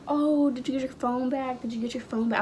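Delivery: high, eager mock classmate voice; nosy classmates. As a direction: imitate pestering kids asking over and over.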